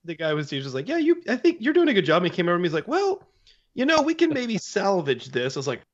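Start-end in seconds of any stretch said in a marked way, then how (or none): 0.9-2.2 high, quick, encouraging teacher voice; imitating an upbeat pottery teacher; high instructor voice. 2.9-5.6 very high, gentle letdown; imitating a polite pottery instructor; high teacher voice.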